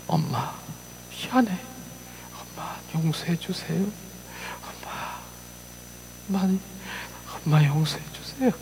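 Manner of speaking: a mild hint of a sobbing child begging; retold crying plea. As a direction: softly voice the little girl's tearful sorry. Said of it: "막 우는".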